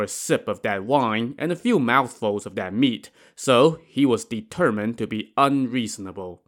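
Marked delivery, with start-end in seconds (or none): none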